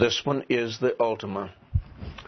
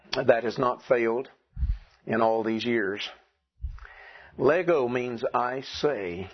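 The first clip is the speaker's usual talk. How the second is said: ordinary talk, nothing marked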